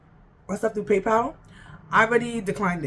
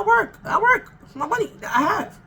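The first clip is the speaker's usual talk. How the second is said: emphatic insistence; 10 semitones higher, 4 dB louder than usual. Real, not acted. Insisting she works.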